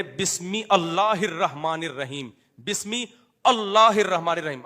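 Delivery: slow بسم, then a separate "Allah" with hamza sounded; mistaken correction. Acted. Playing someone wrongly correcting others' bismillah, mistaken correction. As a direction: break between بسم and اللہ, hitting اللہ. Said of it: "فلاں آدمی بسم اللہ الرحمن الرحیم غلط پڑھتا".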